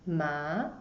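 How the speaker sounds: "ma" starting low and rising; second tone ma. Shown as a demonstration. Teacher showing the second tone, "ma" rising. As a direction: lift "ma" upward like a question. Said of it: "it goes up".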